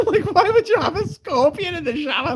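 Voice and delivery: high, loud, laughing disbelief; mock incredulous squeal. Streamer retells a scorpion prank, mock incredulous squeal. Mock outrage in retold story.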